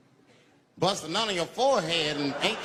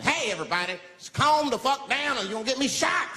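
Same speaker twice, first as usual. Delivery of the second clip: raised, higher pleading call; trying to keep cool; 7 semitones higher, 3 dB louder than usual. Retold or imitated. Man caught in the middle to panicking passengers, urgent appeal. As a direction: appeal over a panicking crowd. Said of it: "I can't lose my cool".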